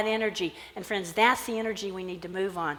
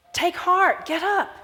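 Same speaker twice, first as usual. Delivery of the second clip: encouraging call; 9 semitones higher, 4 dB louder, slower than usual. Acted. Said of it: "saying to him".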